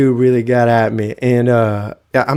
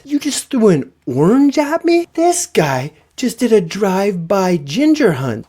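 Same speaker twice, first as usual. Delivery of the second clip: high-pitched incredulous outburst; outraged disbelief; 8 semitones higher than usual. Retold or imitated. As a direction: react as if you cannot believe it. Outraged reaction to thrown orange.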